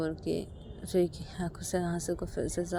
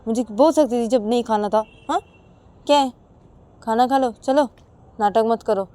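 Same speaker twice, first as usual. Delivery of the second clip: loud, higher-pitched angry lecture; scolding husband; 5 semitones higher, 12 dB louder than usual. Acted. Husband scolding wife over wasted food, loud and angry. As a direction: tell someone off with sharp questioning tags.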